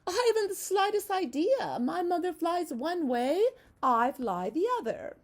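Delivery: butterfly, breezy and unbothered; lightly voiced butterfly. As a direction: play the carefree butterfly, lifted. Butterfly voiced in a read-aloud.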